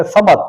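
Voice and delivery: سمت in two syllables, "sa-mat"; غلط تلفظ. Shown as a demonstration. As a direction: put a short a on the meem in سمت, "samat". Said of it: "اس پر بھی زبر پڑھ دیتے". (سمت، میم پر زبر کے ساتھ)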